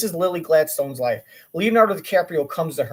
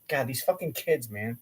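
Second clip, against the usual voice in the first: usual speaking voice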